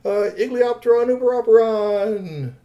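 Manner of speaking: high, loud, drawn-out acclamation; shouted salute. A narrator's reading. Four crew members hail their crown prince, slow shouted salute. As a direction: proclaim it like a crew hailing royalty. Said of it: "came up and all shouted".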